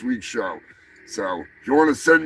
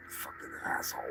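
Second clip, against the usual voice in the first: whispered curse; 21 dB quieter, faster than usual. Acted. Muttering insult at heckler.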